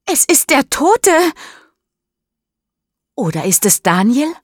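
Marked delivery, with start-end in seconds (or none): 0.0-1.1 high, loud horrified shout; shrieking in terror; screaming. Acted. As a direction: yell it in panic. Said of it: "schreit Mischa".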